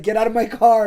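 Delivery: half-laughing command to leave; mock order. Girl orders him out.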